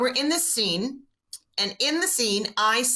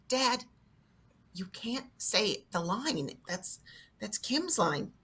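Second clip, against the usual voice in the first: soft, slow reprimand; acted discreet on-set scolding; hushed correction; 8 dB quieter, much slower than usual. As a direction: quietly set dad straight.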